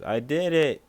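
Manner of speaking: loud, high exclamation; sudden high-pitched holler; drunken outburst. Acted. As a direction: blurt it out at full volume like a drunk. Drunk uncle blurts out in story.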